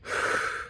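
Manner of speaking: tired airy sigh; breathless sigh. Real, not acted. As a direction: exhale out of breath. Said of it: "kind of out of breath".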